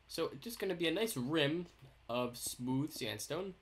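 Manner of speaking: usual speaking voice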